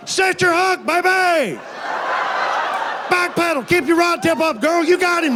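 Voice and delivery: high, excited coaching yells; coaching shout. Retold or imitated. Husband shouting fishing tips to wife fighting the cow, high excited yell. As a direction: cheer her on like a fishing coach.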